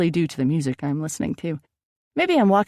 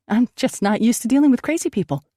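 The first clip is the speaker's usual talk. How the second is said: raised-pitch, defensive retort; 7 semitones higher, 4 dB louder than usual. Acted. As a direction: justify yourself, stung and protesting. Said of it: "I said defensively".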